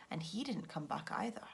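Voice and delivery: plain reading voice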